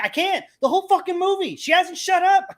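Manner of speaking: high-pitched exasperated outburst, retold; loud and angry. Husband answering the plea to stop, loud and angry. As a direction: re-enact losing it at the movies. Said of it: "I was so loud and so angry".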